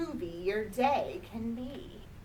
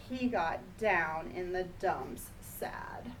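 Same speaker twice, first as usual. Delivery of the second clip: sad whisper; 6 semitones lower, 6 dB quieter than usual. A narrator's reading. Reading sad moment of picture book.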